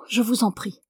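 plain reading voice

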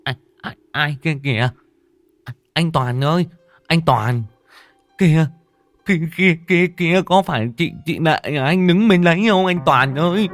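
terrified stammering. A narrator's reading. Kiên spotting a ghost by the bamboo, panicked stammer. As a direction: stammer in rising fright. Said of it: "miệng lắp bắp".

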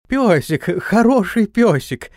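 high cooing to a dog; praising a dog. A narrator's reading. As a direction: coo sweetly at the dog, slowly. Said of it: "засюсюкал Антон".

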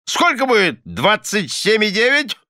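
high-pitched, fierce interrogation; fierce questioning. A narrator's reading. Husband testing his wife's sums, fiercely. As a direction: brush her off, then fire the question. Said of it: "свирепо спросил мистер Корнер".

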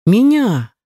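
plain reading voice

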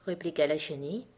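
ordinary delivery, nothing marked

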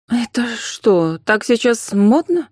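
high, slow incredulous question; appalled. A narrator's reading. Anfisa looking at the graveyard photos, appalled. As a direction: ask slowly, taken aback. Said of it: "ужаснулась Анфиса".

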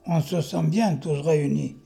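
slow, measured verse delivery with lifted emphasis; poem recitation. Acted. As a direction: recite your poem to the room, loud and deliberate. Recites own poem aloud. (récitation de poème, lente et bien scandée)

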